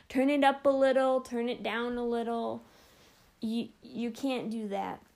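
normal talking voice

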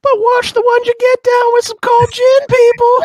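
mock ad pitch.

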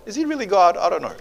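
high-pitched, wavering question; doubting. Acted. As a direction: voice a doubter losing confidence. Voices John's doubt from jail.

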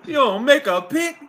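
high, loud disbelief; loud high-pitched heckle; exasperated needling. Real, not acted. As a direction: yell it at a stalling friend. Host heckles stalling co-host.

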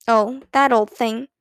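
ordinary delivery

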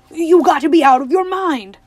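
very loud, high outraged roar. A narrator's reading. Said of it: "roared Tuck".

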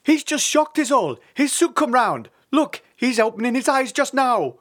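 high, loud bird dad voice; big bird parent calling out; father osprey. A narrator's reading. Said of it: "said the osprey".

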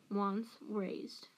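plain reading voice

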